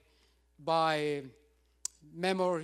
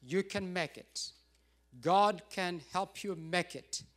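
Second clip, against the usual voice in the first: normal talking voice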